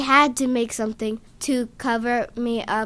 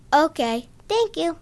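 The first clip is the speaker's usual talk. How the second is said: higher, tiny squeaky thank-you; squeaky mouse-like voice; 5 semitones higher, 4 dB louder than usual. A narrator's reading. Zinkoff thanking the man, squeaky and mouse-like. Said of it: "in his squeaky mouse-like voice".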